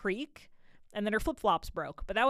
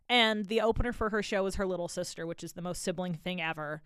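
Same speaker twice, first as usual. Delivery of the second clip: ordinary talk, nothing marked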